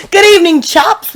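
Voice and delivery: high, hammed-up British hello; playful high British accent; British greeting. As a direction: greet them as a put-on Brit. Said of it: "We would call people chaps".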